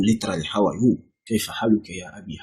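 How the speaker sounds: usual speaking voice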